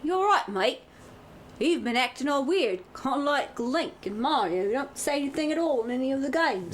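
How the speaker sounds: high, cheeky Cockney banter; Cockney, light and mocking; Cockney accent. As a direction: tease a mate in Cockney. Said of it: "what kind of accent I'm gonna do".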